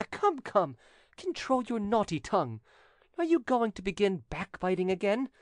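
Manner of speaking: high-pitched, haughty reproof; scolding widow. A narrator's reading. Widow scolding her backbiting cousin, haughty. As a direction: rebuke him like a naughty backbiter.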